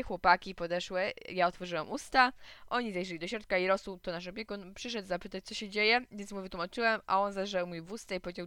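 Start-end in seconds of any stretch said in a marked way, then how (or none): none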